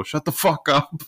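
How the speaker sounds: high, drawn-out mock heckle; half-yelled heckle from the stands; imitated crowd heckler. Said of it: "someone in the crowd go".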